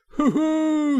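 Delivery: high, loud triumphant hoot; gleeful whoop. A narrator's reading. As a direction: whoop with self-admiring glee. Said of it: "he cried, in the ecstasies of self-admiration".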